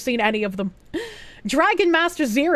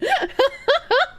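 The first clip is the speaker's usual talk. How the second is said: very high, squealing laugh; rising high cackle; 14 semitones higher than usual. Real, not acted. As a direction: crack up helplessly. Cracks up at old comedy bit.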